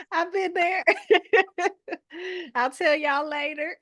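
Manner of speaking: high, loud voice bursting with laughter; high-pitched and loud through big laughs; laughing confession. Retold or imitated. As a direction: crack up while saying it. Laughing retort to cousin's remark.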